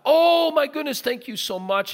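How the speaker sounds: high, loud burst of gratitude; touched, gushing thanks. Retold or imitated. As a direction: imitate an overjoyed woman gushing. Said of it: "she was so touched".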